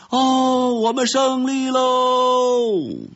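high, drawn-out triumphant cheer; victory shout. A narrator's reading. Red ants at the nest gate cheer their victory, shouting high and long. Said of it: "传来的叫喊声".

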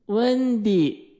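put-on high, drawn-out child's call; high-pitched little-boy whine; protesting. A narrator's reading. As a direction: call her name in protest. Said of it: "迈克尔抗议说".

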